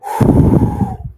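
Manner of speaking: a loud breathy blow; a strong puff of air; blowing sound. Shown as a demonstration. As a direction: blow the ghost inside with one puff. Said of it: "a simple blow will get the ghost inside".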